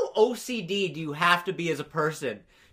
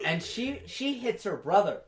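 usual speaking voice

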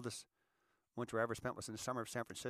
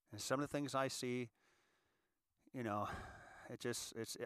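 usual speaking voice

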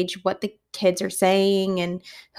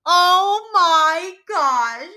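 high, drawn-out retold disbelief; shocked; 9 semitones higher, 3 dB louder, much slower than usual. Teacher reacting to kid's blurted word, shocked. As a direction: stretch the first words out in shock.

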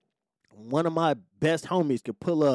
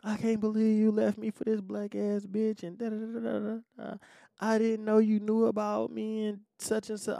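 higher whiny imitation of an upset ex; mock distraught ex; 7 semitones higher than usual. Ex confronting him outside the apartment, mock distraught whine. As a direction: whine it like a hurt girlfriend. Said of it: "she's distraught".

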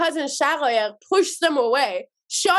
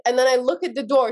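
ordinary delivery

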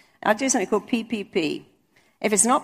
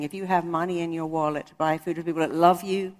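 usual speaking voice

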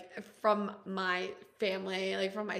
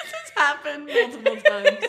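laughing; 5 semitones higher, 10 dB louder than usual. Real, not acted. Laughing agreement.

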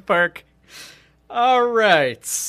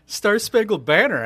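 usual speaking voice

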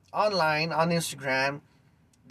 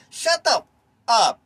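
higher, louder angry outburst; angry shout; 3 semitones higher, 5 dB louder, slower than usual. Real, not acted. Ranting to Instagram followers, shouting angrily. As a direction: snap it out, furious at the commenters. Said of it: "sorry if I sound like I'm angry".

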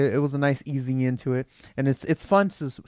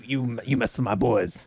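tough pirate; 5 semitones lower, slower than usual. Retold or imitated.

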